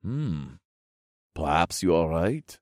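musing, subdued regal voice; ponder, then concede slightly; thoughtful king. Acted. King conceding to the hero, thoughtful voice. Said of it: "the king mused in a thoughtful tone".

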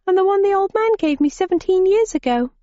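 put-on high girlish voice; young girl voice. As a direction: play a stubborn young girl.

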